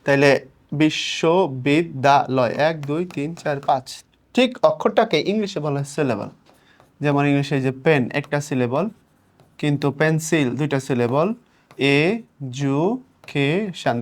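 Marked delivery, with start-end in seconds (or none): 0.7-2.5 বিশ্ববিদ্যালয় slowly broken into its syllables; slow বিশ্ববিদ্যালয়, each syllable set apart. Shown as a demonstration. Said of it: "দেখো কতগুলো অক্ষর আছে". 11.8-14.0 এ, জু, কে, শান very slowly, each syllable held apart. Shown as a demonstration. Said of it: "দেখছো syllable বাড়তেছে".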